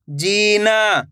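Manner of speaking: ordinary delivery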